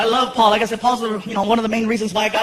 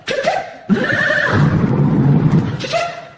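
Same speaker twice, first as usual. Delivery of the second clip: imitated car noises. Shown as a demonstration. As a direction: mimic a remote car starter with your mouth. Imitates remote-start car sounds.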